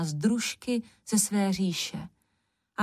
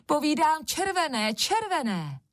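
high, insistent squabbling cry; quarrelsome shouting; 8 semitones higher than usual. A narrator's reading. Third sister insisting on her colour, quarrelsome high cry. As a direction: argue back high, falling away on the repeat. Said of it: "zase dali do křiku".

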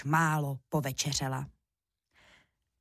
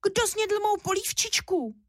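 very high little bear voice; unhappy thin squeak; 13 semitones higher than usual. A narrator's reading. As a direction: squeak it like an upset bear cub. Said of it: "Vypískl nešťastně tenkým hláskem". (nešťastné vypísknutí tenkým hláskem)

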